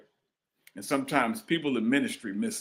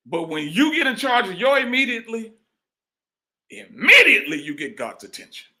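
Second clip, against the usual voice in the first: higher then louder preaching cry; preaching at full force; 10 semitones higher, 6 dB louder than usual. Acted. Preacher pressing the church to act, at full force. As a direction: build it to a shout.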